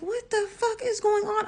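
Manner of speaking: high-pitched retold panic; bewildered alarm. Mother watching her baby squirm, high bewildered alarm.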